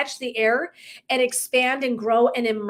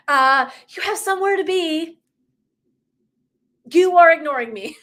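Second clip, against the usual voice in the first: higher and louder, scolding tone; sassy inner voice; 5 semitones higher, 6 dB louder than usual. Retold or imitated. Play the nagging soul, pushy and higher. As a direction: inner voice scolding her for not listening, sassy. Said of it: "this voice was like".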